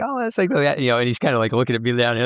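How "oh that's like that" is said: much higher, mock-modest reply; flattered performer. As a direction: toss it off like a pleased star. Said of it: "and he's like".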